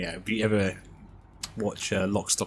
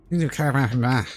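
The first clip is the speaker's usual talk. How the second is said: Irish Pikey line; 5 semitones higher, 6 dB louder, faster than usual. Retold or imitated. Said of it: "Plays the Irish Pikey".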